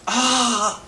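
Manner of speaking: high, loud imitated yelp of panic; hammed-up freak-out cry, high; mock scream. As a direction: yelp like a cop who snapped. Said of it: "he just went".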